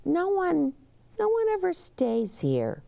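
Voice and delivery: high, eager bellhop voice; a bellhop's nervous high voice. Acted. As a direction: play the bellhop up high and fussy. Bellhop voiced in read-aloud.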